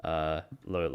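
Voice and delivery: ordinary talk, nothing marked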